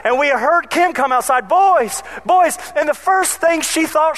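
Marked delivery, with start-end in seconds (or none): 1.5-2.6 calling out. Retold or imitated. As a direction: call out searching the yard. Babysitter calling for lost kids.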